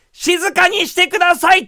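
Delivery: high, loud exasperated receptionist; stern demand. Retold or imitated. Receptionist snaps at deaf granny mid-show, stern. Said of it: "ってきつめに言ったら".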